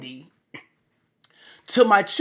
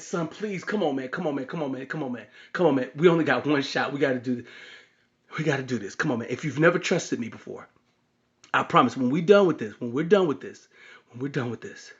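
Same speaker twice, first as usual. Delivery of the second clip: low, soft, repetitive coaxing; hushed pleading; 7 semitones lower, 4 dB quieter, faster than usual. Retold or imitated. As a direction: plead under the breath with a reluctant child. Father pleads with reluctant son.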